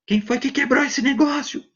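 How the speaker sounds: demanding at a pitch held high above his own; gruff accusing voice. Shown as a demonstration. Teacher acting a gruff adult demanding who broke something. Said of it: "se você fala grosso".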